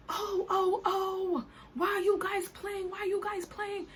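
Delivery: high, slow put-on protest voice; mocking the driver who thinks they are joking; imitating the driver. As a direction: whine it like the upset driver.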